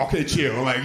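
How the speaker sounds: ordinary talk, nothing marked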